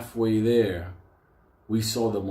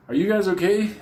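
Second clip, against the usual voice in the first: paramedic asking; 9 semitones higher, 6 dB louder than usual. Retold or imitated. Paramedic asks.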